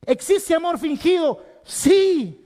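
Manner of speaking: loud, high preaching voice; full-force sermon delivery; fiery preaching. Acted. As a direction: preach it out to the whole church.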